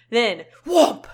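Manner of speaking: loud whoomp; whoomp sound effect. Acted. Reader voices the bear striking, loud whoomp. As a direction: hit it like a bear slam.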